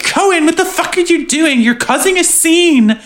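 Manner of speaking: high, exasperated squeal as Summer; mock Summer. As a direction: shriek at Cohen in mock disbelief. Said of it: "And Summer's like".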